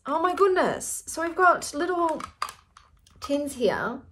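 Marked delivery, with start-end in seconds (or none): 0.1-1.0 high amazed gasp of a line. Real, not acted. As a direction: say it stunned, voice jumping up. Amazed at the products.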